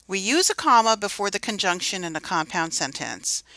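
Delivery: usual speaking voice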